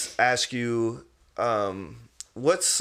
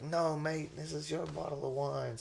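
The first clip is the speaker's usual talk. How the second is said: put-on British accent; 5 semitones higher, 10 dB quieter than usual. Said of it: "He was like".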